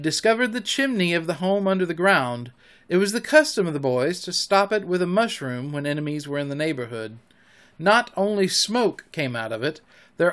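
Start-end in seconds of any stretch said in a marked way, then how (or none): none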